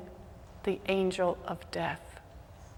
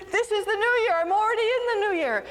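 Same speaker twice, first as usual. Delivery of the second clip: put-on much higher, loud, happy drunken cry; tipsy joy; 13 semitones higher, 4 dB louder, faster than usual. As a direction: celebrate like the party's peak. Drunk Moshe celebrates.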